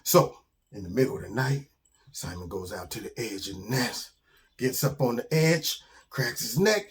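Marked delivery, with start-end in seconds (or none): none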